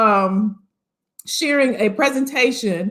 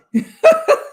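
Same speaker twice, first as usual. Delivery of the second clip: high delighted laugh; 4 dB louder than usual. Real, not acted. Laughing at viewers still hugging.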